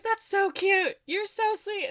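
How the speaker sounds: high, imitated gushing reply; acting out someone charmed; delighted gushing. Voices author gushing over gift.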